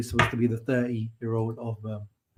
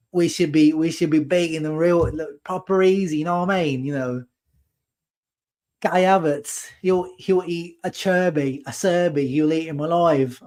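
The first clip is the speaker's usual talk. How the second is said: higher-pitched put-on Cockney, sneering at Italian clubs; mock Cockney fan accent; 4 semitones higher than usual. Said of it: "My accent sketches are great".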